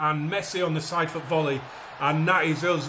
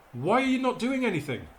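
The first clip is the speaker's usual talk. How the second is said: higher, pleading frustration at the team; real exasperation, voice pitched up; exasperated outburst; 6 semitones higher than usual. Real, not acted. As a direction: throw the question at useless players. Manager frustrated at his players.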